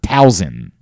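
"Towson" said slowly with a z sound; "Towson" drawn out, Tow-zund; wrong Towson. Shown as a demonstration. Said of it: "local broadcasters that do that".

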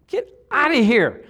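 laughing dismissal. Real, not acted. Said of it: "laughs at the memory".

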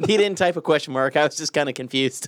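usual speaking voice

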